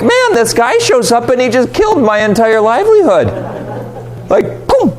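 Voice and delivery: high, exasperated pig owner. Retold or imitated. Pig owner complaining about his dead herd, mild exasperation. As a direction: voice the owner's outrage lightly. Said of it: "the owner of the pigs".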